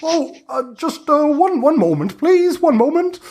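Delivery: put-on very high, fussy old witch voice; a crone begging for a moment; flustered hag. Hag answers the players.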